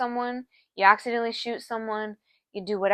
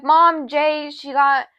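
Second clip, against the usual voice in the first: higher, louder panicked call to mom; 5 semitones higher, 7 dB louder than usual. Retold or imitated. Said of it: "So I'm like".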